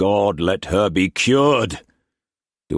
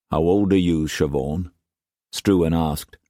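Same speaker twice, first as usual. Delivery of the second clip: plain reading voice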